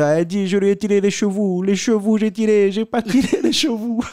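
high, loud exaggerated Italian accent. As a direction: imitate an Italian man protesting. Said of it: "accent italien". (accent italien exagéré, voix aiguë)

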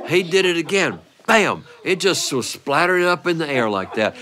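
normal talking voice

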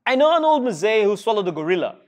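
usual speaking voice

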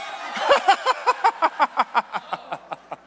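loud burst of real laughter. Real, not acted. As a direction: laugh out at the stampede. People rush for the book.

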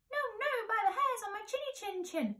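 high, squeaky little-pig voice; defiant little pig. Acted. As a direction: squeal it out like a tiny piglet.

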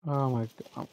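normal talking voice

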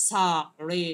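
সা and রে each punched separately; firm separate stress on সা and রে; stressed syllables. Shown as a demonstration. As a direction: hit সা, then রে, with a short gap. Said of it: "এগুলো stress দিয়া use করে".

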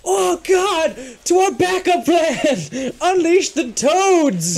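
high, slow, loud mock panic; mock desperate cry. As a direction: cry it out like a doomed hero. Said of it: "they're like".

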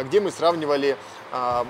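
usual speaking voice

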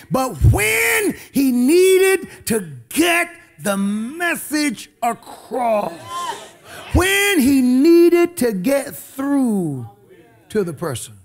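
slow booming sermon build; preaching at full force. Acted. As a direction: stretch every word like a preacher rising. Preaching climax, drawn out.

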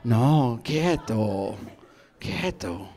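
low, slow seductive command; paisa woman. Retold or imitated. Paisa woman tells her dance partner stay still, low command. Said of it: "La paisa me miraba".

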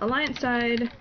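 usual speaking voice

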